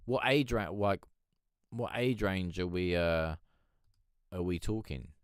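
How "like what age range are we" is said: ordinary talk, nothing marked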